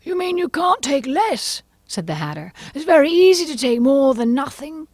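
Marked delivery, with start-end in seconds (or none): 0.0-1.6 high, excitable Mad Hatter; fussy, animated gentleman. A narrator's reading. 2.7-4.9 high, excitable Mad Hatter; fussy, animated gentleman. A narrator's reading.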